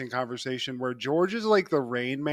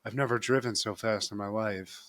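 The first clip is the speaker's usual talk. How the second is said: flat monotone, lower and softer; 5 semitones lower, 5 dB quieter than usual. Retold or imitated. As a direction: drone it out with no life. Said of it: "It's, like, very monotone".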